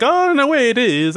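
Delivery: high, exclaiming mock Italian accent; over-the-top Italian accent. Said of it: "just over-the-top Italian accents".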